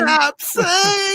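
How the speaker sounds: high, loud mock outrage; shouting match. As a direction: yell it like a wronged kid. Mock outrage over all-caps tweet.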